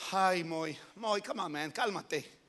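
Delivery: low, soft calming aside; casual soothing. Acted. God tells Moses to calm down, casual and soothing. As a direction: talk a friend down gently.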